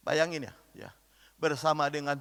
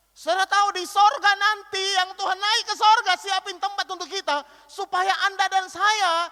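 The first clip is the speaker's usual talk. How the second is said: full-force preaching; 11 semitones higher, 6 dB louder than usual. Acted. Climactic preaching.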